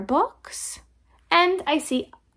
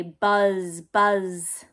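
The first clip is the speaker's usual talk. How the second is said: low buzzing; 3 semitones lower than usual. Shown as a demonstration. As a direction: buzz drawn out low like a bee. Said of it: "The bee will say".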